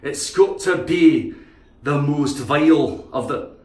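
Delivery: slow, rolling Scottish verdict; Scottish accent. Acted. Speaker damning the most vile, slow Scottish accent.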